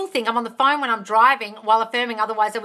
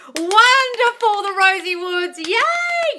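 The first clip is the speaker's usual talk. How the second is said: much higher, louder, slow squeal; squealing delight; 12 semitones higher, 8 dB louder, much slower than usual. Real, not acted. Squealing delight at a viewer's comment. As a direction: squeal with joy.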